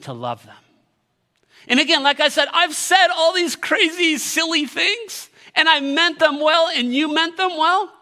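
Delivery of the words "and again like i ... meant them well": loud, high, urgent sermon; fervent, raised-voice appeal; impassioned preaching. Real, not acted. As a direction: preach it with full force. Preaches at full force.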